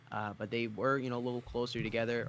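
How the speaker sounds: ordinary talk, nothing marked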